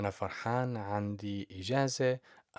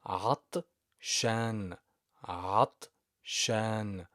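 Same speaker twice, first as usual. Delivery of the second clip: slow model word; much slower than usual. Shown as a demonstration. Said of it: "slow repeated model word".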